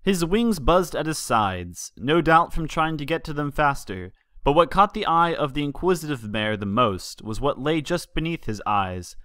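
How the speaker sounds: ordinary delivery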